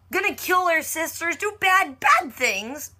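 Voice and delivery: high, whiny mock pout; pouty protest. Fan conceding Rachel's crimes, high mock-pouting protest.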